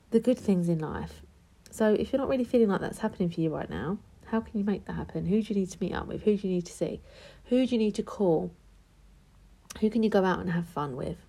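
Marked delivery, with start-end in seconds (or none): none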